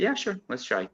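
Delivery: ordinary talk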